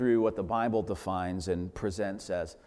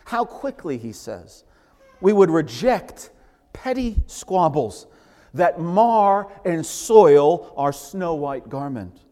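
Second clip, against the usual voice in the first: higher and louder, slowing on key phrases; fervent preaching; 5 semitones higher, 7 dB louder than usual. Acted. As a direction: urge the flock, drawing words out. Fervent exhortation.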